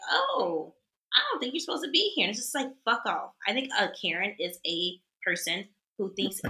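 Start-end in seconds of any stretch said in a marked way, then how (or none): 0.1-2.2 higher, quicker prissy scold. Retold or imitated.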